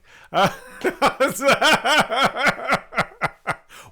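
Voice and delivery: big high burst of laughter; laughing. Real, not acted. Laughing at own joke.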